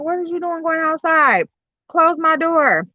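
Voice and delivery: high-pitched mock indignation; retold scolding parent. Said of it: "I'm like".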